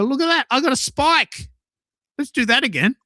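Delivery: mock thrilled at a spike; excited. Host reenacting his delight at a traffic spike, excited. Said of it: "and I go".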